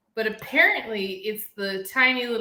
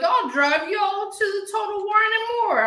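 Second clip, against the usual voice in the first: high, lilting mock of the bar guy; a lightly sung imitation of the bar guy; sing-song imitation; 9 semitones higher than usual. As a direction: sing the offer back. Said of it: "he's like".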